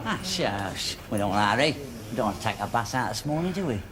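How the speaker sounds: low, hushed aside; whispering. Acted. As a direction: keep it down, conspiratorially. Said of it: "What are you whispering for?".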